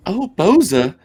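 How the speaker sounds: high, loud put-on Southern belle wail; high frantic woman's voice begging for her son; scrawny frantic woman. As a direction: cry out for help like a panicked mother. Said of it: "Let's hear it".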